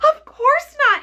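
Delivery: put-on high innocent little girl voice; a child answering puzzled; Dorothy answering. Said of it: "answered Dorothy".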